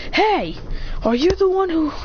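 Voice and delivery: high-pitched calling out; confrontational challenge. Acted. Dog boy confronting the demon, challenging.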